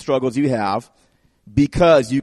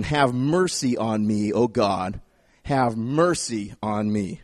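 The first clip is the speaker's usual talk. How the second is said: humble plea; 7 semitones lower, slower than usual. Acted. Quoting David's humble plea.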